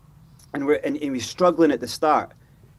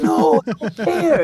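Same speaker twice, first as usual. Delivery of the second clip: slow, louder mock call; retold shout; 5 semitones higher, 5 dB louder, much slower than usual. Xavi retold directing Palhinha, mock shout slow and loud. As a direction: bark the order slowly, as if across the pitch. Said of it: "he was shouting at him".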